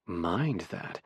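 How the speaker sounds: low, soft intimate murmur; whispered. A narrator's reading. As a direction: whisper it against a kiss. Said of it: "I whisper against our kiss".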